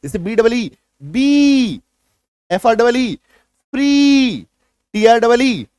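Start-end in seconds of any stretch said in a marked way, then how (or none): 1.0-1.8 बी held long and higher; forceful long vowel. Shown as a demonstration. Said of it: "थोड़ा जोर से उच्चारण करेंगे". 3.7-4.5 फ्री with a long, high ee; forceful long vowel. Shown as a demonstration. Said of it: "थोड़ा जोर से उच्चारण करेंगे".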